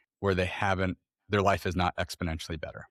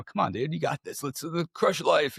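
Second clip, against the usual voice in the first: higher-pitched, lightly acted cheerleading dad; mock pep talk; 7 semitones higher than usual. Dad retelling his pushy pep talk.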